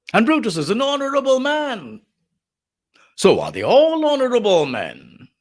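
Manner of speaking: high, swooping sarcastic declamation. Acted. Lecturer as Antony praising Brutus to the crowd, heavily sarcastic. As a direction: make the praise ring false, pitched well up. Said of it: "Of course, he's being sarcastic".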